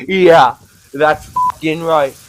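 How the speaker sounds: ordinary delivery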